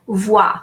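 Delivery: voix said as "vwa"; French pronunciation. Shown as a demonstration. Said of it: "O I is pronounced wa".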